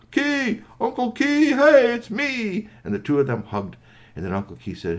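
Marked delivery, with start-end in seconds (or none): 0.1-2.7 high, loud call, last words stretched; jubilant, high-pitched hollering; excited greeting. Acted. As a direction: hail an old friend across the room. Friend arrives and calls to Uncle.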